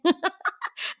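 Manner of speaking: a quick amused laugh; short laugh. Real, not acted. Vlogger laughing at her own "make it happen, baby", short laugh. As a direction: laugh at your own line. Laughs after English catchphrase.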